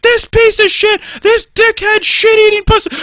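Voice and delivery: loud, very high ranting; mock road rage. Imitating a driver right before a crash, furious yelling. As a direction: scream insults like a hammed-up furious driver.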